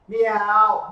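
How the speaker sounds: high, loud cat meow. Acted. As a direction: call out a big meow on cue. Everyone says meow together.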